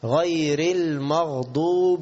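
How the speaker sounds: very slow tajweed with long madd; Quran recitation. Shown as a demonstration. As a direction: hold the vowels at great length. (ترتيل بمد طويل)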